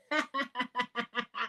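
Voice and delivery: long, high, soft laugh; helpless giggling. Real, not acted. Host breaks down at her own defence, helpless giggling.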